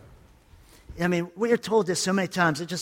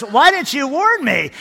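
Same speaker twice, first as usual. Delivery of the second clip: mock indignant complaint; 7 semitones higher, 5 dB louder than usual. Hit pastor complains in joke.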